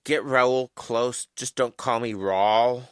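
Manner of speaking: ordinary talk, nothing marked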